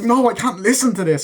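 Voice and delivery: high-pitched mock exasperation; acted upset refusal. Acts refusal in family argument.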